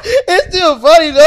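slow, high, laughing words. Real, not acted. Laughing at friend's story.

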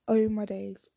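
loud shocked cry of disbelief; shocked exclamation. Retold or imitated. Retold shock reaction.

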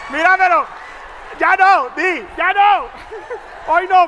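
loud playful shout; loud teasing shout. Real, not acted. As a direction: shout it across the room. Shouting jokes across room.

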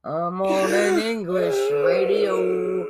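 slow, drawn-out put-on voice; mock radio call. Co-host parodying activists calling on a radio, slow hammed-up voice. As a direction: stretch it out as a silly radio voice.

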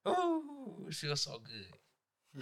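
slow, soft savouring; evil relish. Real, not acted. Teacher relishing his revenge fantasy, slow and gleeful. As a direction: drag it out, relishing it. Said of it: "You are evil".

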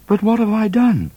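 bewildered innocence; startled Midnight. A narrator's reading. Midnight protesting to Cat, high and startled.

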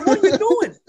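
loud, high-pitched exasperation; retold yell. Storyteller scolding classmate by the drug dog, retold high yell. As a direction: yell it in disbelief. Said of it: "I yelled at him".

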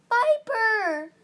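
high, loud, desperate name call; scream. A narrator's reading. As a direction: scream out for a lost companion. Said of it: "Fate Speaker screamed".

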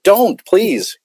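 pleading. Retold or imitated. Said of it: "I said".

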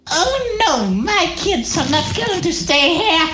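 very high cartoonish anime girl voice; rejected anime character. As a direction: squeak it out like an anime heroine. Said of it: "she's still gonna have that voice though".

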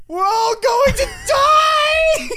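drawn-out, very high screamed wail; panicked yell. Retold or imitated. Retelling his panic on the field, hammed-up high yell. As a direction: scream it slowly like a terrified kid. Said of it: "just yell".

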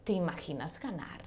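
soft, wistful whisper of a dream; dreamy hushed question. Retold or imitated. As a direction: breathe it like a secret hope. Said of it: "y le dije".

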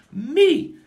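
high, loud one-word answer; triumphant shout. Real, not acted. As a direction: slam down the punchline. Answering his own question.

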